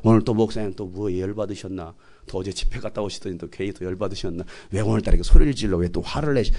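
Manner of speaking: low, soft behind-the-back complaint; hushed, low-pitched grousing; grumbling congregant. Acted. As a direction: grumble privately about the pastor.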